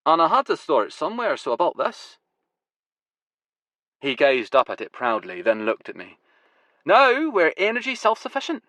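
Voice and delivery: showing off what he built; proud builder; Huey's voice. Acted. Huey proudly shows the tank.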